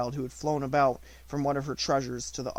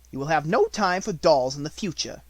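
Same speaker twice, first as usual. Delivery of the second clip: louder, harsh headmistress voice; domineering schoolmistress, louder; stern Miss Minchin; 3 semitones higher, 7 dB louder than usual. A narrator's reading. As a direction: order it coldly.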